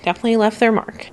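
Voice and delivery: normal talking voice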